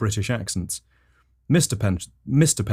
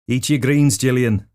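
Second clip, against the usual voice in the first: louder, higher Scouse dad; Scouse accent; 4 semitones higher, 6 dB louder than usual. Acted. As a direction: order the child in loud Scouse. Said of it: "had a Scouse accent".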